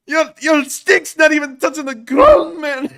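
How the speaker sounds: high, loud, slow shouted meme line. Retold or imitated. Streamer shouts the meme line to the chat in a high voice. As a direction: belt it out like the meme guy. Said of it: "I hope that it was an angry Scottish accent".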